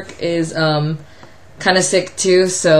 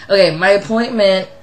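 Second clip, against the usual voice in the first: ordinary talk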